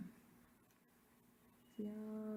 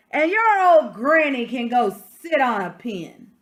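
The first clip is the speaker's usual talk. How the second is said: louder, a taunting yell; mock yell; 3 semitones higher, 15 dB louder than usual. Third pig yelling an insult about granny, mock yell. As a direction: throw the insult at him. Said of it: "the third little pig yelled".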